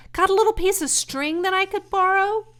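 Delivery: high, loud eager piglet; eager pig asking. Acted. Wilbur asking Templeton for string, eager. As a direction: ask the favor brightly.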